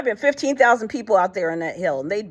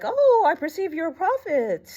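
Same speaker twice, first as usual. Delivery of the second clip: lightly voiced woman, pitched up and awed; high mock woman's voice; 10 semitones higher than usual. Woman at the well to Jesus, high and amazed. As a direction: play her sudden realization, voice lifted. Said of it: "she was like".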